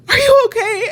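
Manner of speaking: high, loud mock of a worried coworker; worried colleague checking in; concerned coworker. Coworker asks her.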